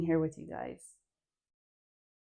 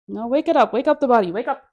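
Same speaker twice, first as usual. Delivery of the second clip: higher, louder call to wake the body up; loud rallying call; 5 semitones higher, 7 dB louder, faster than usual. Shown as a demonstration. Instructor rousing her dance class to wake up, louder and higher. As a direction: rouse the room like a coach shouting. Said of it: "your shrill voice will do it".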